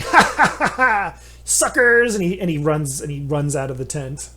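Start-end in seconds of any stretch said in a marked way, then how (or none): 0.0-2.1 high, loud mocking cackle; gleeful cackle. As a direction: cackle like a gloating trickster. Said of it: "the gnome just starts cackling".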